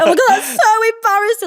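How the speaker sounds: high, laughing mortified reaction; embarrassed. Retold or imitated. Housemate retelling being teased by her crush, embarrassed. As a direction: cringe out loud through a laugh.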